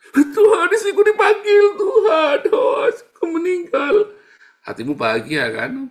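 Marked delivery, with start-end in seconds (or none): 0.1-4.2 very high, loud widower's wail; hammed-up sobbing lament to God; fake crying. Preacher mimics husband whose wife has died, pretend wailing. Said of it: "pura-pura nangis".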